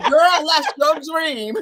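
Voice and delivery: slow, loud, high and laughing through it; mock scolding while cracking up; laughing family quote. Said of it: "and they like".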